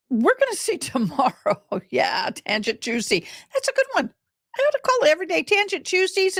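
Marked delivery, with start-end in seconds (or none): 3.5-6.4 delighted. Real, not acted. Delighted at own joke.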